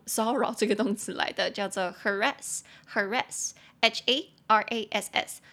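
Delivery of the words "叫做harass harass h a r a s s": ordinary delivery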